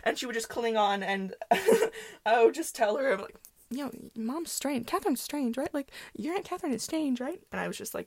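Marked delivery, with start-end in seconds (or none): 3.7-7.4 gossiping. Retold or imitated. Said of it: "we're gossiping".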